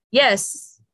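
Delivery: usual speaking voice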